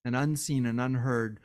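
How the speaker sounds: ordinary talk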